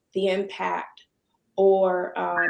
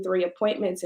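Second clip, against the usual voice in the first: usual speaking voice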